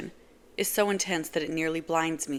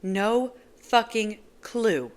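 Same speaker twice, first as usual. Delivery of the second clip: ordinary delivery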